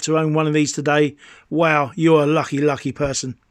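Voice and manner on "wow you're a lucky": usual speaking voice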